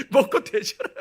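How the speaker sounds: loud, laughing through the mother's curse; laughing mock curse. Laughing at mother's gruff curse, loud. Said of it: "지금도 기억나".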